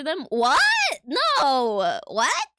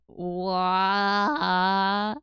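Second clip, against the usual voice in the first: lower, mock meme voice; a quick lower impression of the meme guy; imitating meme guy; 5 semitones lower than usual. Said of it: "Burger King foot lettuce guy going".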